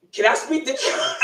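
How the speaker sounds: mock phone request dissolving into laughter; about to crack up. Imitating brother asking for a customer, cracking up.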